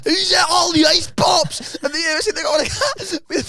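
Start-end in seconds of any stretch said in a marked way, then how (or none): 0.0-1.7 loud, high, slow outraged shout; outraged yell. Retold or imitated. Colleague finding the ice pop box empty, outraged yell. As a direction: bellow it slowly like an affronted colleague.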